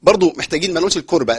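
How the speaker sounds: says الكربة with a plain k, shown as the mistake; teacher demos the wrong الكربة with k; wrong k sound. Shown as a demonstration. Teacher demos the error الكربة with k for students. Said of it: "الكربة بالكاف ما تنفعش خالص".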